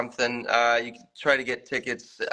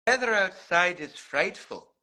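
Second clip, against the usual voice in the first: learner's Scottish accent, pitched higher; 7 semitones higher than usual. Shown as a demonstration. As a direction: copy the Scottish lilt line by line. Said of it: "in a Scottish accent".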